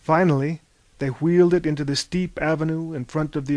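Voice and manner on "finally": plain reading voice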